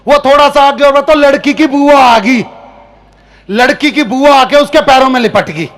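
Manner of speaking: usual speaking voice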